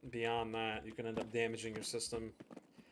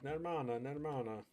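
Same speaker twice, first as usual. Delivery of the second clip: put-on a light Russian accent on the quoted word; 3 semitones higher than usual. Gamer imitating an enemy Russian, light Russian accent. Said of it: "I have a pretty good Russian accent".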